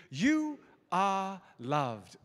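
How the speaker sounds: very slow, drawn-out British accent; stretched, word-by-word British delivery. Shown as a demonstration. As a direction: lead the crowd in your best British accent. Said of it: "in your best British accent".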